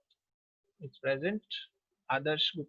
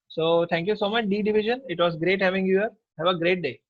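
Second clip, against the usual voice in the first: louder, upbeat goodbye to the whole class; cheerful farewell; 3 semitones higher, 7 dB louder than usual. Real, not acted. Teacher bidding the D division goodbye, cheerfully loud. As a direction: send the class off brightly and loudly.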